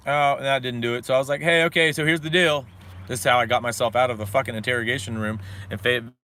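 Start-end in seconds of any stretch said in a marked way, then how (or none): 1.4-2.6 higher, louder bargaining call. Retold or imitated. To the cops outside the interrogation room, loud bargaining call. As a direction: raise your voice to cut a deal.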